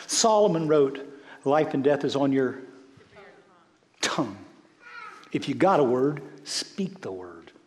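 preaching at full force. Acted. Pastor urging the congregation to focus, full-force preaching. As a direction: preach it out hard to the room.

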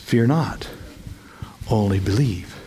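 low, quiet, gentle comfort; tender reassurance. Retold or imitated. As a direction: soothe him softly, very low. Jesus comforting the ruler.

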